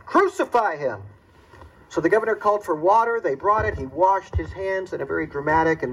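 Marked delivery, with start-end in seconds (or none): 0.0-1.0 crowd's roar. Retold or imitated. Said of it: "a roar came up from the crowd".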